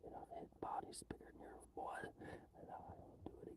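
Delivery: hushed mock threat; creepy whisper. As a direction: whisper it like a horror villain. Whispered villain monologue.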